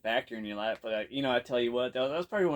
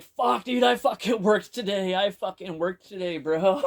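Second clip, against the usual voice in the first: much higher, louder pleading cry; retold protest shout; 8 semitones higher, 7 dB louder than usual. Beggar protests to the man who spat at him, loud hurt outcry. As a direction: shout it like you're hurt and indignant.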